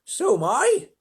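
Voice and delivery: ladybird cry. Acted. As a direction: squeak it out high and eager. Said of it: "the ladybird cried".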